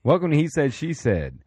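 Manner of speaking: high, punchy welcome dropping on the name; show-opener announcement. Acted. Host restarting the podcast intro, announcer style. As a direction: launch the show with energy.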